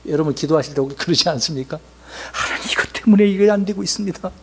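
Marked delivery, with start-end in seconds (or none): 2.3-4.3 mock excuse prayer. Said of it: "이유를 지금 대고 있잖아요".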